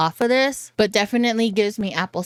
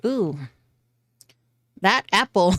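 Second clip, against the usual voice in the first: ordinary talk, nothing marked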